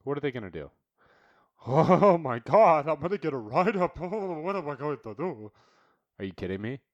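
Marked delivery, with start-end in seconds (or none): 1.7-5.5 high, hammed-up worried worker voice; mock panicked employee. Mocking a timid worker afraid of a write-up, in a high hammed-up voice. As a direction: whine it like a scared employee, laughing at them.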